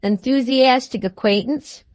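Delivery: ordinary delivery, nothing marked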